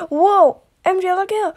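high, loud warning cry; alarmed shout. Acted. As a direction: yell it to warn your friend in time. Sudden danger, warning a friend.

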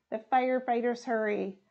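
ordinary delivery

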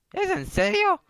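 very high falsetto question; cartoonish girl's voice, incredulous; incredulous girl friend. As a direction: squeak it in put-on disbelief. Girl reacts to coal.

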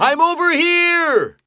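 high-pitched long-distance holler; calling out. Acted. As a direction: call so your child can locate you. Father calling lost child.

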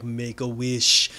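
low, very slow demonic voice; devil incarnate. Retold or imitated. Devilish manifestation urging Dorothy to wish, low and slow. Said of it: "sounded like the devil incarnate".

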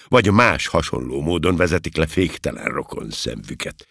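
plain reading voice

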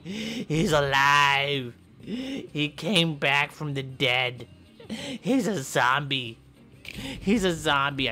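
slow, drawn-out horror announcement; very slow mock-horror proclamation; slow dramatic reveal. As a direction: drag out the zombie news.

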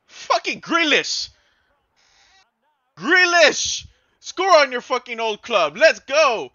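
loud, high celebratory yelling; excited shout. Real, not acted. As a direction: cheer the goal at full voice. Celebrates Grealish goal.

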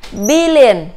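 billion with BIL stressed and a falling end; stress on first syllable. Shown as a demonstration. Teacher drilling billion with BIL stressed. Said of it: "Chúng ta cũng nhấn âm đầu".

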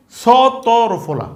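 সতরফলা stretched out very slowly, syllable by syllable; teacher slowly sounding out সতরফলা; slow spelling. Shown as a demonstration. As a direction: draw out সতরফলা so the class can follow. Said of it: "teacher drawing out a spelling".

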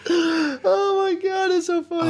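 high, slow, crying with laughter; laughing hard. Real, not acted. Friend cracking up at the story, high and laughing. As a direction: squeal it while cracking up.

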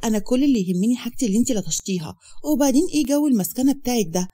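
ordinary delivery

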